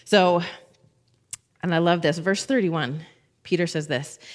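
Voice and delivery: usual speaking voice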